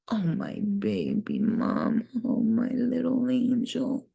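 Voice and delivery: tender pet-talk. Real, not acted. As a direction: coo to a beloved pet. Cooing at pet.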